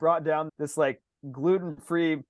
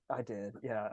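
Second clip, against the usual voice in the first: very low, hushed admission; retold sheepish confession; 9 semitones lower, 15 dB quieter, slower than usual. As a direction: own up under your breath. Retold confession to his wife.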